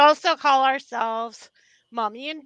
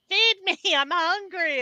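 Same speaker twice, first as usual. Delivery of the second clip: dog voice; 7 semitones higher than usual. Retold or imitated. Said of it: "we give them a voice".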